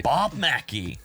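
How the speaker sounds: put-on Bob Mackie in a light American accent. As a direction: say the name like an American would. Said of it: "my American accent".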